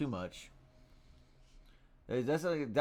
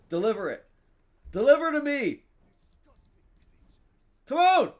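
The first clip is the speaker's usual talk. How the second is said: urgent shouted plea; 13 dB louder than usual. Real, not acted. Streamer begging the game to deliver, urgent shout. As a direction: demand it, high and loud.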